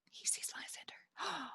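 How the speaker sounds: breathy stage-direction whisper; hushed whisper. Acted. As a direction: whisper it to the audience.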